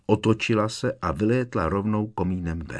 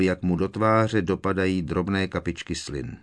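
ordinary delivery, nothing marked

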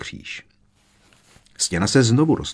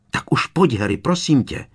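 higher, insistent plea; urgent pleading call; 7 semitones higher, 3 dB louder than usual. A narrator's reading. Hermiona begs Harry to come away, urgent. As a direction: call out, urging him to come. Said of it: "zavolala Hermiona ještě naléhavěji".

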